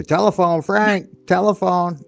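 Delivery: high, slow parrot call; parrot imitation. Parrot calling him to the phone, high imitation. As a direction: call out like a parrot summoning someone. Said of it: "It would say".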